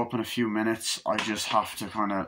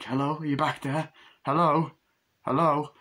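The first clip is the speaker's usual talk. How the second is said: raised-pitch questioning call; imitated calling out; 6 semitones higher than usual. Victim checking who is back there, calling out. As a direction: call to someone unseen.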